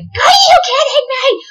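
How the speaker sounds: high amazed shout; shocked disbelief. Retold or imitated. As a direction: yelp it in disbelief. Disbelief, name matches again.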